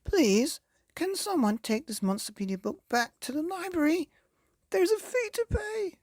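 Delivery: high, pleading panicked voice; Mister Meek pleading from the lake in panic; desperate Mister Meek. Acted. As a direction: beg anyone to save you. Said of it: "he cried".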